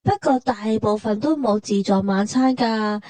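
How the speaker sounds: usual speaking voice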